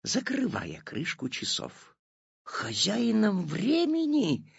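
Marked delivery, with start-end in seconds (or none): 2.4-4.5 slow, high questioning echo; doubtful. A narrator's reading. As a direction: ask back in disbelief. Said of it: "недоверчиво переспросил Петя".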